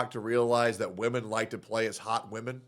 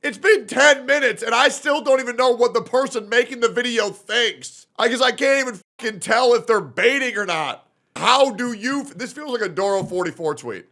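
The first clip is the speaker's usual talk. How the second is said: loud, high exasperated rant; exasperated yelling; 11 semitones higher, 9 dB louder than usual. Real, not acted. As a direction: yell at the screen in disbelief. Streamer exasperated at video.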